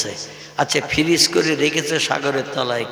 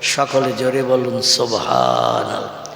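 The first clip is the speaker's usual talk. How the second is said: ordinary delivery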